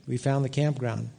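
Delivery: soft, subdued statement; humbled admission. Retold or imitated. Preacher admitting the lesson he learned, quiet humbled admission. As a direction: let it drop to a quiet admission. Sobered repeat before the lesson.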